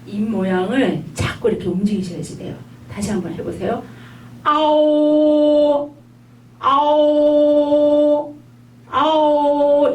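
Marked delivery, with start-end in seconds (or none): 4.4-9.9 아오 stretched slowly, high and loud; long drawn 아오. Shown as a demonstration. Teacher modelling a slow 아오. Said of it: "비율이 거의 비슷해요".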